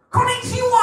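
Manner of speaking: very high, loud Japanese greeting; attempted Japanese accent. Comic attempting a Japanese greeting before admitting he can't do the accent. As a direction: shriek it out in a failed Japanese accent. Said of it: "I can't do the accent".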